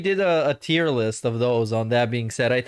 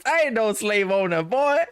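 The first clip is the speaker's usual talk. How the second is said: imitated southern accent; 7 semitones higher than usual. Reactor mimicking the costumed streamer's line, high mock Southern accent. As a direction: parrot his bad Southern accent, pitched up. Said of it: "I need to clip this".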